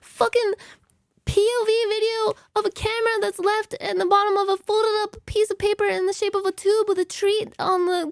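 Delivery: breathless comic tirade; mock angry rant. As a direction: shout every detail as if outraged. Angry rant describing cat video.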